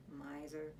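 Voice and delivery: low, soft muttered "Miser"; muttered insult. A narrator's reading. Evan mutters as Jessie slams the door, quiet. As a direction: grumble it under your breath. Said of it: "Jessie heard Evan mutter".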